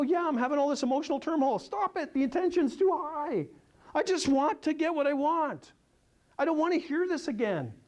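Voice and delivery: mock overwrought complaint; frustrated buyer. Retelling complaining to the presenter, high and exasperated. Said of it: "I go".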